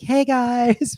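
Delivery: high-pitched, chirpy greeting; Darianne. Retold or imitated. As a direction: greet followers in a high put-on character voice. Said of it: "Darianne is a character".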